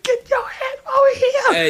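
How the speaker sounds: very high, slow mock yell; mock angry order. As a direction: summon a kid like a fed-up uncle. Acts out uncle ordering child over.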